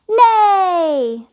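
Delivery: high, loud, long-held yell. A narrator's reading. All the guests vote against going, yelling. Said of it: "they all yelled".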